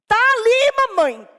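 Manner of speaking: put-on high, loud insolent child's voice; arrogant child. Boy insolently pointing out the man to his mother. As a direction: say it like a cocky little boy. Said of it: "Todo arrogante a criança".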